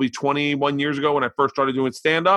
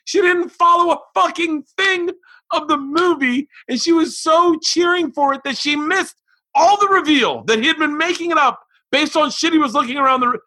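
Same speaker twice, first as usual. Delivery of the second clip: much higher, louder frustrated venting; exasperated rant; 14 semitones higher, 3 dB louder than usual. Real, not acted. Dad ranting about his daughter and the movie, exasperated. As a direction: vent like you can't believe it.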